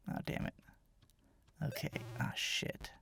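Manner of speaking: hushed frustrated swearing under breath; whispered cursing. Real, not acted. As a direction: mutter the curses to yourself. Muttering curses at a hard level.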